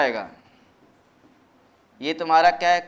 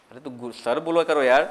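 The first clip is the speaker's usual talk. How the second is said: ordinary talk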